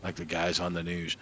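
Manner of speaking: usual speaking voice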